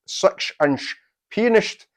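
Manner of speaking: slow Sean Connery impression, s slurred to sh; a light, slow Sean Connery lisp with pianist said as pianisht; Sean Connery accent. Shown as a demonstration. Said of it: "my best Sean Connery accent".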